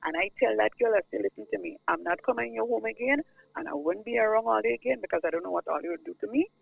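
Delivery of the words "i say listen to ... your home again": normal talking voice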